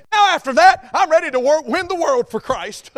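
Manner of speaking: loud, high-pitched sarcastic zeal; exaggerated gung-ho enthusiasm; mock excitement. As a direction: proclaim it like a fired-up convert, hammed up. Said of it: "Don't we just get excited".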